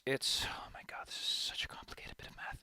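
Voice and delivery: muttered to herself under breath; whispering. Real, not acted. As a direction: whisper it in frustration. Overwhelmed by the calculation.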